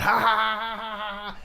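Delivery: high-pitched imitated "ha-ha" laugh; mock laugh. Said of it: "he laughs hell out".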